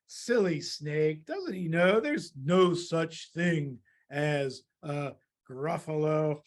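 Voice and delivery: low, slow scoff; knowing mouse. Acted. Mouse mocks the snake who ran away, slow and knowing. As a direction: draw it out as a smug private joke.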